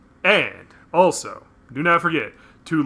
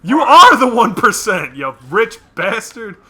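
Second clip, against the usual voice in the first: high, loud outraged yelling; angry rant; 10 semitones higher, 11 dB louder than usual. Pretend. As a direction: yell at him in outrage. Ranting at Bruce.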